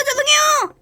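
high shrill cry of sorry; panicked shout. Acted. Big sister shrieks an apology, scared.

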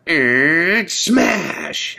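high, loud mock crash noise; imitated door smash. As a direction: bang it out like a door slamming shut.

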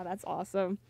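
ordinary talk

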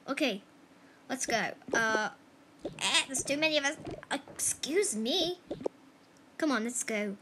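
normal talking voice

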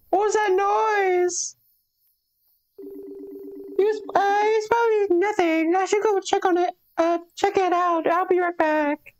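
a tiny high voice going to check; high-pitched character voice. Acted. Voicing character hearing a noise.

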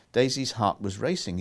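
plain reading voice

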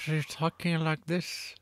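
mock talking from the corner of the mouth; Jeff Bridges. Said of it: "talking out of the corner of his mouth".

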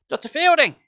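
high, loud calling out; girl cries out loudly to the doctor; loud cry. A narrator's reading. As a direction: shout after him. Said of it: "she cried loudly".

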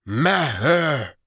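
high, loud call with mangled consonants; wolf creature calling. A narrator's reading. False wolf calls its master at the hut door, loud and mangled. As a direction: bark out the name, dropping the consonant. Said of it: "it had trouble with some consonants".

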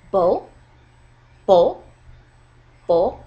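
"bo" loud, shooting up from low to high; rising tone. Shown as a demonstration. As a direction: punch out "bo" with a quick rise. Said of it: "It goes from low to high".

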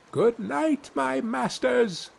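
high, slow teasing farewell; little boy taunting the men as he slips away; cheeky Tom. A narrator's reading. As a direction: bid them goodnight mockingly as tiny Tom.

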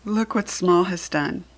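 ordinary delivery

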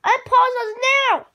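usual speaking voice

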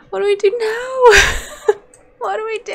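high, half-laughing half-sobbing; laugh-crying. Real, not acted. Player laugh-crying after losing the mummy. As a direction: laugh through the despair.

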